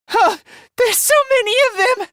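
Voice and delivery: high-pitched, daunted alarm. Acted. Fighter seeing so many enemies coming, alarmed. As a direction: voice jumps up at the sight.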